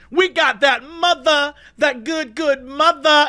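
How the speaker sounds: high, drawn-out imitation of a shouting drunk; mock triumphant shout. Drunk men back from CVS with alcohol, mock gloating shout. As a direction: crow it slowly like a drunk showing off. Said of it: "shout at all of us".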